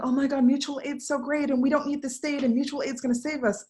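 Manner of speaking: a light mock of abled folks gushing; mocking gushing ally. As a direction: parody a naive fan of mutual aid. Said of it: "abled folks just being like".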